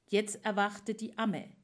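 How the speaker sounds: ordinary delivery, nothing marked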